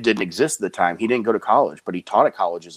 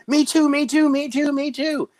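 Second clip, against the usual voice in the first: high, eager me-too chant; a bouncy kid begging to join in; "me too, me too" squealed much higher, eager; hyperactive kid. Retold or imitated. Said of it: "that super hyperactive kid".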